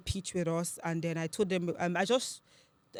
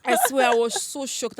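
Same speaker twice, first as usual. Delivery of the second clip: high, loud, slow insistence; excited, drawn-out exclamation; giddy emphasis; 6 semitones higher, 9 dB louder than usual. Real, not acted. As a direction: stretch it out, still giddy. Laughing while recalling her shock.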